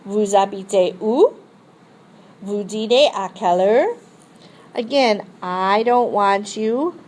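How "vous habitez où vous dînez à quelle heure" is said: où and à quelle heure lifted at the end; rise on question word. Shown as a demonstration. Teacher showing casual question intonation. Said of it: "The voice rises on the interrogative expression".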